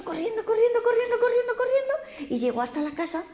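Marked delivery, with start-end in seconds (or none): 0.0-1.9 very high, quick running chant; high chant of the pig running; running fast. A narrator's reading. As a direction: chirp each corriendo higher.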